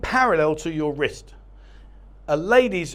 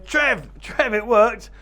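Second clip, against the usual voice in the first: excited calling out; 4 semitones higher, 5 dB louder, slower than usual. Retold or imitated. Lads excitedly calling out to him.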